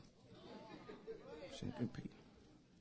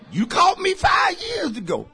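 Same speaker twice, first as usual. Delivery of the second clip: exclamation; 12 semitones higher, 5 dB louder than usual. Acted. Preacher calls out to women.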